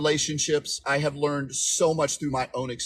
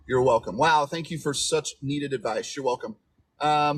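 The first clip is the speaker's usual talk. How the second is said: ordinary talk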